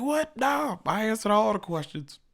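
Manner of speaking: high-pitched mock tirade at the media; comic high-voiced denial and accusation; mimicked Trump. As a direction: imitate an indignant politician, voice pushed way up. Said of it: "He was like".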